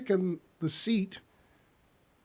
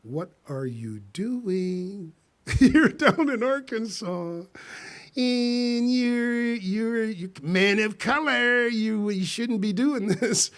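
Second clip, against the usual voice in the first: higher inner voice, laughing at himself; 6 semitones higher, 4 dB louder, slower than usual. Retold or imitated. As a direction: scold yourself while half laughing. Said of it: "and I'm thinking".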